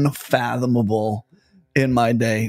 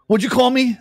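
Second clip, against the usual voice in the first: much higher, sharp indignant question; offended retort; 11 semitones higher than usual. Retold or imitated. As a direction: someone who feels insulted. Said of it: "And people were like".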